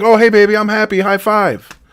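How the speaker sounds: mock an over-bright pep talk; sarcastic imitation of a cheery spouse; mock cheerful wife. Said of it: "people don't talk like that".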